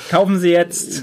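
bright, high salesman pitch; chipper 1950s ad. Pretend. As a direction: sell it like an old TV commercial. Mock ad pitch after "Surprise!.

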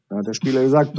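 normal talking voice

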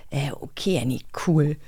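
unimpressed. Retold or imitated. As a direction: shrug it off like a bored colleague. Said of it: "Alle so".

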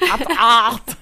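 slow, loud drawn-out call; Doktor Lüdi. Retold or imitated. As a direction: belt it out slowly like a showman. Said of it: "wo er halt auch so sagt, so". (langsamer, lauter Ausruf)

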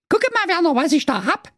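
high, far-off Saxon wife voice; squeaky proud housewife showing her bundle; wife's voice. Acted. Wife Waltraud showing husband the baby, high squeaky voice. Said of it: "da sprach seine Frau".

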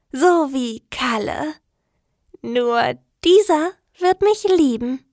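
lightly voiced, fixated and yearning; obsessive young woman. A narrator's reading.

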